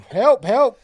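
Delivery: loud, high-pitched cries for help; retold hollering. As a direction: holler for rescue in the retelling. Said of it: "And I'm hollering".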